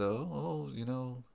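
George Lucas. Retold or imitated. Podcaster mimicking director at the hairstylists, half-hearted impression. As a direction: attempt a voice and give up on it. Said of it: "I can't do his voice".

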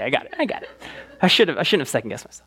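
ordinary talk, nothing marked

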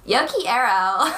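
put-on slow, drawn-out California Valley Girl Spanish. Shown as a demonstration. Host showing off her favorite Spanish, mock Valley Girl accent. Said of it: "Valley Girl accent ever".

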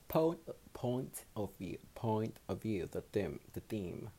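ordinary talk, nothing marked

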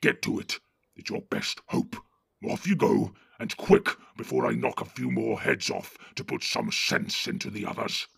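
gruff orc chief giving orders; Ugluk the orc captain. Acted. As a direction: bark orders at the cowardly Northerners. Ugluk orders Northerners away.

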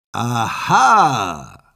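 loud, scornful triumphant aha; mocking. The witch springs on the prince, loud scornful aha. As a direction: gloat at the caught intruder. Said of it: "rief sie höhnisch".

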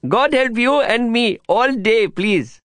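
put-on high, slow child's voice; a little boy repeating earnestly, slowly; small boy. A narrator's reading. Boy mimics the narrator.

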